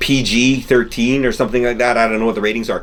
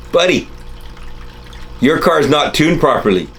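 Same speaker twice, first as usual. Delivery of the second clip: know-it-all stranger; 6 semitones higher, 3 dB louder than usual. Retold or imitated. Know-it-all stranger lecturing him.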